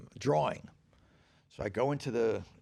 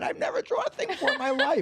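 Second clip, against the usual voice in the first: very high, loud defensive outburst; indignant protest; 7 dB louder, faster than usual. Retold or imitated. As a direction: protest at the top of your range. Defends himself against girlfriend's criticism.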